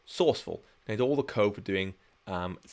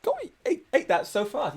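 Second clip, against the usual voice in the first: teasing the dog. Real, not acted.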